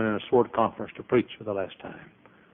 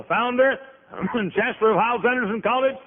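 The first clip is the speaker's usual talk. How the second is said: very high-pitched mock amazement; incredulous questioner; 12 semitones higher than usual. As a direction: pile on the grand title, pitched way up. Acted questioner lists grand titles.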